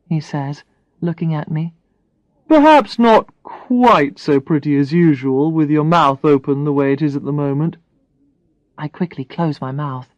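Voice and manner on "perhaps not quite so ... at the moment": Brad. Acted. Brad voiced by narrator.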